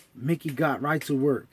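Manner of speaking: ordinary delivery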